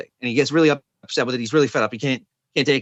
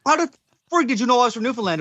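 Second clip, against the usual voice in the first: exasperated Newfoundlander; 11 semitones higher than usual. Acted. A Newfoundlander in Ontario exasperated at being pegged, high. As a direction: blurt it out like a fed-up Newfoundlander. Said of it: "And he said".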